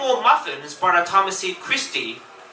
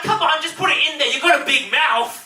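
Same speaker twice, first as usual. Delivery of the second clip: put-on high, fast teasing girl; pushy girl; 9 semitones higher than usual. Author as [name] urging her friend to eat, high and fast. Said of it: "I'm like".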